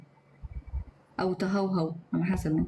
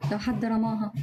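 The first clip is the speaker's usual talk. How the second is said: usual speaking voice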